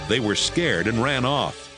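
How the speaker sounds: ordinary delivery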